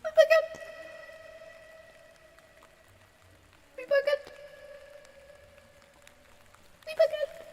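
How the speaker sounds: put-on high loud hammy French accent; mock French accent. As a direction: shout it like a cartoon Frenchman. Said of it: "the French accent".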